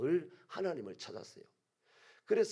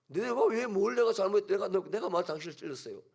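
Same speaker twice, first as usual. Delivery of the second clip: much higher and louder protest; indignant retort; 6 semitones higher, 7 dB louder than usual. Acted. Eve protesting to her husband Adam, loud and indignant. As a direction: fire it back, offended.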